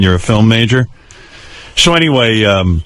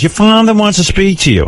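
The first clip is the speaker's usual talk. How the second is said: mother's voice; 9 semitones higher than usual. Retold or imitated. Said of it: "so my mother goes".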